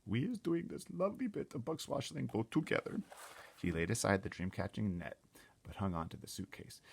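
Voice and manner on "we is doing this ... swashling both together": high, loud giant voice with odd grammar; BFG voice. Acted. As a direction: play the gentle giant, cheerful and big. BFG line repeated.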